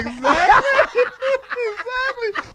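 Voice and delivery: squealing with laughter, high; laughing. Real, not acted. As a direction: laugh the word out. Laughing agreement.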